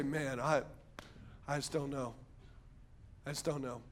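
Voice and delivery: low, very soft and halting; despondent. Acted. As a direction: trail into quiet helplessness between long pauses. Acting someone who has lost hope.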